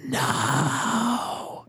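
long, held-back cry of NO; drawn-out mock yell. Spectral figure calls out as you escape, held-back yell. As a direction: yell it out without full volume. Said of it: "he yells out".

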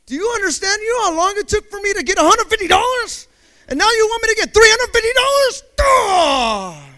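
high, loud comic outrage; hammed-up indignant yelling; upset outburst. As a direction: rant at full pitch, ending in an exasperated cry. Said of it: "I was so upset".

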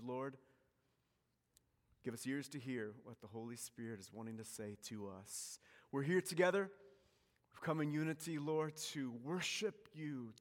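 soft, earnest prayer voice. Acted. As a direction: pray it quietly over the room. Pastor leads a prayer.

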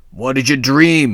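curious devil. A narrator's reading. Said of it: "he asked".

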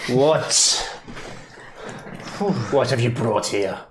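lightly voiced, accented burgomaster; wary burgomaster. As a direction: question the visitors as a village leader. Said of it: "I go over to the Burgomaster".

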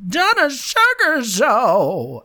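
put-on high, slow, grand old dame; hammy old-woman announcer, high and stretched. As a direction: draw it out like a theatrical old lady. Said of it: "I'm sounding like an old woman".

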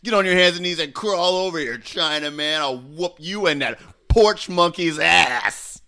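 improvised bully taunt. Acted. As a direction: taunt him, stretching the slur. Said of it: "quite a bit of improv there".

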